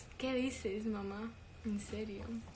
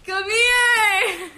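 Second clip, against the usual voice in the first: loud, high drawn-out holler; high, wailing shout; calling out; 9 semitones higher, 14 dB louder than usual. Real, not acted. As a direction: yell for someone to come over. Calling someone over.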